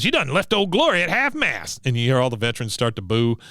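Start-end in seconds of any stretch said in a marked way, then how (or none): 0.0-1.8 lightly voiced old soldier, pitched high; a hint of a scolding veteran, voice lifted; mock drill-sergeant veteran. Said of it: "He goes".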